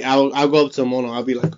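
usual speaking voice

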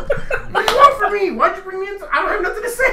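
very high, loud whine through laughter; mocking wife. Husband mocking his wife refusing to back him up. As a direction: whine like she has no part in it. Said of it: "she starts, like, doing that shit".

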